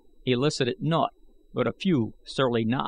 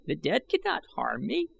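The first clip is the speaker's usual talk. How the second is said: lightly voiced old man; 6 semitones higher than usual. A narrator's reading. Old man's boast.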